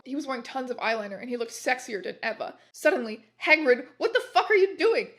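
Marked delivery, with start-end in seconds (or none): 3.4-5.1 high, loud shout at Hagrid. Acted. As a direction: yell it across the room. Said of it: "he shooted".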